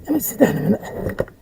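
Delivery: out of breath, puffing between words; panting after the climb. Real, not acted. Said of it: "I'm out of breath".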